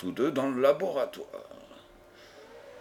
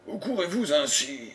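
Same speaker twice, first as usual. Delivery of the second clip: startled, slightly raised question; very surprised; 3 semitones higher than usual. A narrator's reading. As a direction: ask it in surprise. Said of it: "très surpris". (très surpris)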